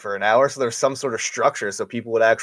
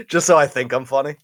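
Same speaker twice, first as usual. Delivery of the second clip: laughing; 5 semitones higher than usual. Real, not acted. Laughing at the laugh track jab.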